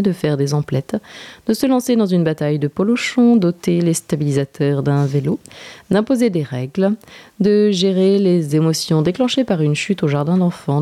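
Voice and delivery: ordinary delivery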